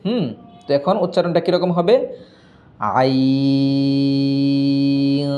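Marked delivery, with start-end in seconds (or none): 3.0-5.3 আইন drawn very long, ending in a nasal hum; slow আইন with ghunna at the end; stretched with nasal end. Shown as a demonstration. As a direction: pull আইন long, then hum the n through the nose. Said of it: "লাস্টে গুণনা হবে একটু".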